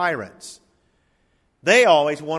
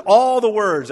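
all the words, louder and higher; louder repeat with all the words hammered; stress on all; 6 semitones higher, 9 dB louder, slower than usual. Shown as a demonstration. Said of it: "repeats louder".

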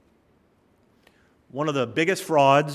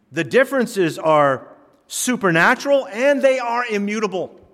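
raised, higher-pitched declaration; emphatic preaching; 8 semitones higher, 8 dB louder than usual. Real, not acted. Preacher rebutting a claim that all religions agree, emphatic and loud. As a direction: hammer it home, loud and high. Preacher drives his point home.

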